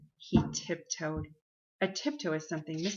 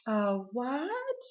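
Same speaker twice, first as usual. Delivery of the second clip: pretending not to hear; 6 semitones higher than usual. A narrator's reading. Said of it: "pretending not to hear".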